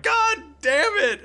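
very high, strained curse; acted outburst of a flustered guard; exasperated guard. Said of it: "the guard was like".

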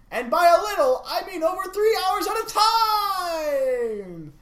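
high, hammed-up yelling with stretched endings; exclaiming everything. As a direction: shout each phrase like it ends in exclamation points. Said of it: "have an exclamation point at the end".

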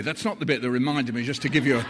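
normal talking voice

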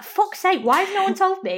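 high-pitched comic outrage at friends; mock exasperation. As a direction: exclaim it high, exasperated at not being warned. Retold outrage at smudged mascara.

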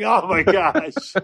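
embarrassed laugh. Retold or imitated. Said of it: "I was like".

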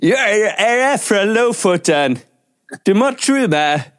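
put-on Norwegian in a thick English accent, high. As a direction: play an Englishman faking a Lofoten local. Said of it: "en engelskmann og begynt å snakke nordlending".

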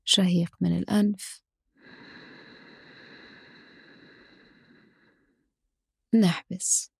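slow, calm breathing cues; guided breathing. Acted. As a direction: count the breath slowly and softly.

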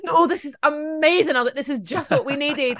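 mock delight. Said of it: "And I'm like".

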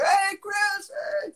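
high, far-carrying greeting shout; calling out a greeting. Retold or imitated. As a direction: call out to a friend across the room. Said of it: "I'm like".

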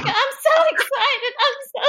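high, slowed wail breaking into laughter; mock hysteria. Quoting the classic scene to co-host, mock hysterical wail. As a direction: parody a frantic TV meltdown.

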